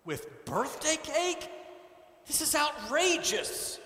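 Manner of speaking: high, outraged protest; indignant. A narrator's reading. Chairman protesting birthday cake communion, high and indignant. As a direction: scoff at the idea in disbelief.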